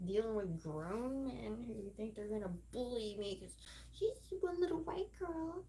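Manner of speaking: ordinary talk, nothing marked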